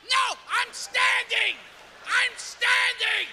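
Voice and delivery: high, slow stubborn denial; insisting he's fine. Acted. To a worried friend, high stubborn denial. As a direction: drag out the denial, pitched high.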